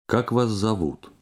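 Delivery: ordinary delivery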